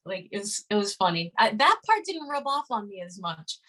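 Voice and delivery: ordinary talk, nothing marked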